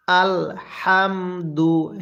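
very slow আলহামদু with a light puff of air on the H; airy H. Shown as a demonstration. Teacher has learners repeat আলহামদু, slow airy H. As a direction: sound out আলহামদু syllable by syllable, breathing out the H. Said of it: "হাটা উচ্চারণের সময় মুখের মধ্যে হালকা বাতাস বের করবেন".

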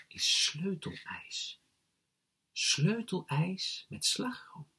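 slow, hushed, breath-heavy reveal; secretive whisper. A narrator's reading. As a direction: share the secret slowly and softly. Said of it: "fluisterde ze".

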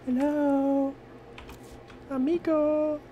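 high singsong hello; playful high call; mock calling out. Real, not acted. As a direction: tease the monster, calling out.

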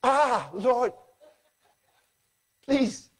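high, pleading plea to the Lord. Pretend. Believer caught unready begs the Lord, pleading. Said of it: "I wonder what you'll say".

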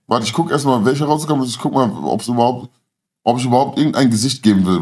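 ordinary talk, nothing marked

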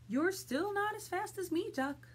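high-pitched storybook horse; horse's boastful thought. Acted.

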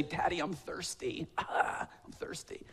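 put-on soft, breathy little-kid plea, trailing off to a whisper; sleepy toddler whine. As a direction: play a thirsty toddler at 2 AM, barely above a whisper. Toddler voiced waking dad.

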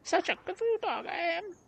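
very high, squeaky dog voice; little dog. Acted. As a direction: pipe up as a tiny talking dog.

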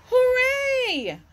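high, loud happy shout; joyful cheer. Acted. George and Jess high above the sharks, joyful cheer. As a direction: cheer like you've just been rescued. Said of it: "cried George and Jess".

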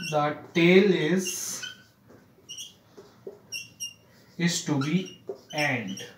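very slow, broken into pieces for copying; slow dictation. Shown as a demonstration. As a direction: read the sentence out bit by bit. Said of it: "teacher dictating an example sentence". (टुकड़ों में धीरे-धीरे लिखवाना)

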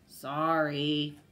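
drawn-out, loud grudging sorry; mildly grumpy lion; grumbled apology. A narrator's reading. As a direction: grumble it like a big cat. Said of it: "grumbled the big, bald cat".

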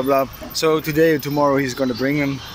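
ordinary delivery

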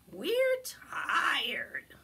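whispered tired critter voice; sleepy little friends. Acted. Tired friends whisper to Bear at bedtime.

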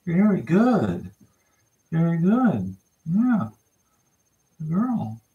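high, drawn-out sing-song praise for a pup; puppy praise. Real, not acted. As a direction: stretch each word up high.

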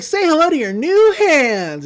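high, bright proud announcement; cheery Mabel. Retold or imitated. Mabel showing Grunkle Stan his new hands, high and proud. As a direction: present it proudly. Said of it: "she's like".

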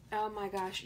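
ordinary talk, nothing marked

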